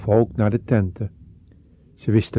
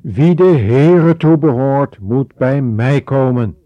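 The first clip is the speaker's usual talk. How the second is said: much louder, summoning cry; loud call; 4 semitones higher, 9 dB louder than usual. A narrator's reading. Mozes calling the faithful to him, loudly. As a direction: call out across the camp. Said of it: "Opeens riep hij luid".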